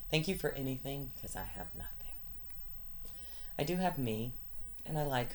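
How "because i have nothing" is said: whispering, near tears; whispered. Real, not acted. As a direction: drop to a bare whisper. Plea trails to whisper.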